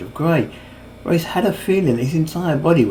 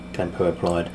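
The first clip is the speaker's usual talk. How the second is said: plain reading voice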